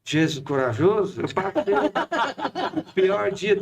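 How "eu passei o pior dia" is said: laughing. Real, not acted. Recalling his fear, laughing.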